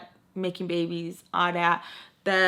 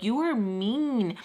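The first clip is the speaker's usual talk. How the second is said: drawn-out, higher-pitched mock hurt; 4 semitones higher, much slower than usual. Friend blaming her for being mean, retold hurt. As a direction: stretch it slowly like a wounded complaint. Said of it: "That's what hurt her the most".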